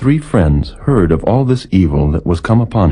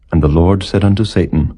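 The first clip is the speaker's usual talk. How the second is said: ordinary delivery, nothing marked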